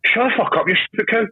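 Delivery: high, raging retort; mock furious yell. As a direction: retell the furious outburst. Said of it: "I was like".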